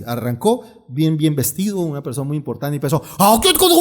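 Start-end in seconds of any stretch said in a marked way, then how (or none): 3.2-3.8 sudden much higher, louder burst like a fierce foreign preacher; hammed-up harsh preacher outburst, high and loud; mock Korean preacher. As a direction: imitate a stern Korean pastor starting his sermon with a jolt. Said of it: "empezó así".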